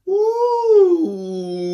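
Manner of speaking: high, loud excited ooh; a thrilled ooh. Real, not acted. Excited about planting phase.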